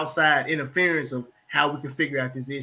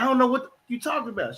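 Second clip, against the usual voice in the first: rushed denial; 8 semitones higher, faster than usual. Retold or imitated. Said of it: "He said".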